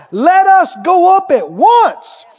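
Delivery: loud, high, drawn-out battle cry; rally the troops with total conviction; slow, ringing declaration of victory; bold faith. Acted. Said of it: "That's how faith talks".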